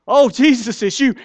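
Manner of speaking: mock relief at seeing Jesus; relieved cry. Most of us seeing Jesus, loud relieved cry. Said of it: "most of us would say".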